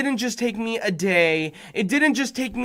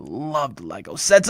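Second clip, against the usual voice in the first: ordinary delivery, nothing marked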